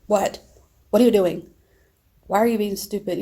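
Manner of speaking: put-on a teen yelling at his upset mother; rude yell. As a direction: shout it rudely at her. Rude son shouting at mom.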